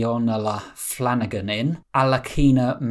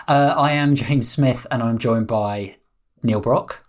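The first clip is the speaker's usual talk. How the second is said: ordinary talk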